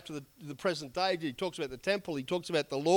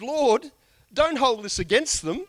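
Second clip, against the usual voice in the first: raised, earnest plea to God; pleading prayer; 7 semitones higher, 6 dB louder than usual. Retold or imitated. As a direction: cry it out as a dying man's prayer. Stephen's dying prayer, voiced.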